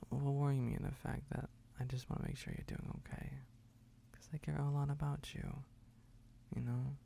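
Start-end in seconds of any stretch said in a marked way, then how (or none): none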